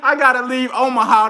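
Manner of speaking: high-pitched, reading through laughter; cracking up. Real, not acted. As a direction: barely get the joke out while laughing. Cracks up reading a viewer's joke.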